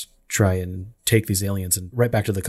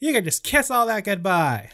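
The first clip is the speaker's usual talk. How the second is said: much higher, hammed-up indignant quote; mock hostile; 11 semitones higher than usual. Imitating the heroine warning the company board, mock hostile. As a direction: playfully act out her outraged warning. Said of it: "she was really hostile".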